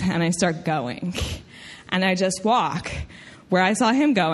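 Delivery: ordinary delivery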